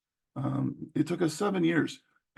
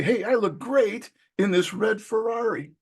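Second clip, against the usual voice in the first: higher, bright boast about the car; excited show-off; 6 semitones higher than usual. Retold or imitated. Buyer showing off his new red Ferrari, excited. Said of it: "I might say".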